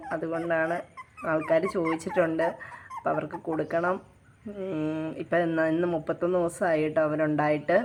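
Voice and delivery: quiet reluctance. Real, not acted. Owner unwilling to give puppies away, quietly subdued. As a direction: let the voice sink, reluctant to part.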